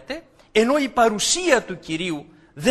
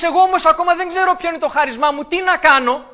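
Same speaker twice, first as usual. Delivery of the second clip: fast, higher and louder, anxious; anxious believer; 6 semitones higher, 5 dB louder, faster than usual. Retold or imitated. A believer telling the preacher he still doesn't know, fast and anxious. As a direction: blurt out the worry quickly.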